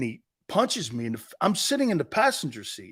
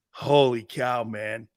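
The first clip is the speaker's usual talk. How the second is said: ordinary talk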